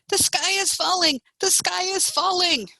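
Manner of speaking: high-pitched gleeful alarm; storybook group shout. A narrator's reading. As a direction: call it high, like a chanting crowd. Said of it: "They shouted".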